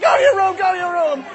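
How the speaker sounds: high, loud screamed order; furious mother. Acted. Mother chases son to his room, furious yelling. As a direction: shriek the command twice over. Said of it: "She's like".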